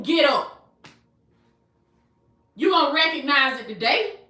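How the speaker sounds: emphatic, rhythmic preacher cadence; impassioned preaching in building refrains; full-force preaching. Real, not acted. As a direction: drive each repeated phrase home to the congregation.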